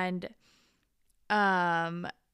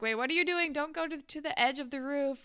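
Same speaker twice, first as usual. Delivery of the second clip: higher-pitched worried warning; mock alarm; 7 semitones higher, faster than usual. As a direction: imitate someone urgently calling a friend back. Friend's alarm on roof acted out.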